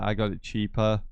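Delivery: ordinary talk, nothing marked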